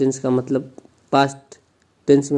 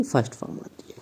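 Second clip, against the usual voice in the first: whisper; 3 semitones lower, 13 dB quieter, faster than usual. Shown as a demonstration. Answering own question softly.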